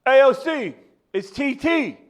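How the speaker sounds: high, excited tourist voice; mock host. Co-host imitating host pushing AOC in New York, high. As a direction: ham up a clueless New Yorker wannabe.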